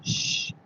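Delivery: soft, long shush; quiet someone with a breathy shh; hushing shh. Retold or imitated. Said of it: "and I went".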